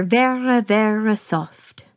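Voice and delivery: drawn-out Scottish "vera, vera" for very, slowed way down; Scottish accent. Retold or imitated. Dogs explaining babies to Lady, slow mild Scottish accent. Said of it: "my Scottish accent".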